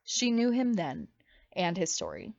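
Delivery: ordinary delivery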